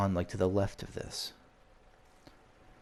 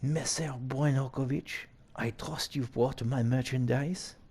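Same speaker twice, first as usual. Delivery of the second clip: put-on slow, higher French-accented villain; 4 semitones higher, slower than usual. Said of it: "you want the French accent".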